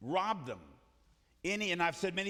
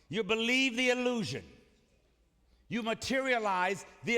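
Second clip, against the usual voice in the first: preaching at full force; 5 semitones higher than usual. Acted.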